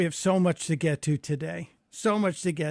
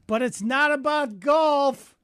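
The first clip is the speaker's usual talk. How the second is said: parting jab; 8 semitones higher, 3 dB louder, slower than usual. Retold or imitated. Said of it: "And I said".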